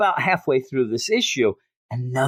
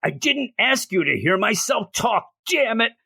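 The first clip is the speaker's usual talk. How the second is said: a lightly voiced angry Batman, somewhat raised; hint of an exasperated shout; mock screaming; 6 semitones higher than usual. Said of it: "And he's just screaming".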